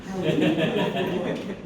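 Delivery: sassy, laughing purr of a greeting. Shown as a demonstration. Speaker shows her own sassy self-greeting. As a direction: give it sass and a laugh. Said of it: "Mine's gonna be the sass kind of".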